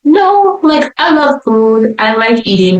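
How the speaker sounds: high, insistent denial; starts very high, settles while insisting; emphatic protest. Retold or imitated. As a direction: push back on a wrong assumption. Protests eating disorder diagnosis.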